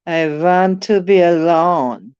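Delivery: put-on slow, drawn-out Garbo-style accent; a hammed-up foreign diva accent, slow; mock foreign accent. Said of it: "I don't know where that accent came from".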